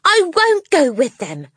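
put-on high, loud stubborn child; defiant shout. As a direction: refuse it loudly. Matilda voiced.

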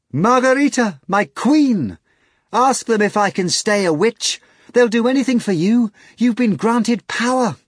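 high, loud excited plea; eager pleading. A narrator's reading. Natasha begs Margarita to let her stay a witch. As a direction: beg her queen eagerly.

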